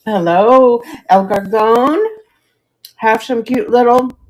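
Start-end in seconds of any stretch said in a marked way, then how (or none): 3.0-4.2 crunching ice while talking; ice in mouth. Real, not acted. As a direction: talk through a mouthful of ice. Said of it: "sorry for crunching on ice".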